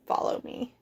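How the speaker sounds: soft, whispered command; hushed whisper. Acted. Zork leads the cow onto his planet, whispered.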